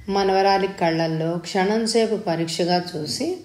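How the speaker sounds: ordinary delivery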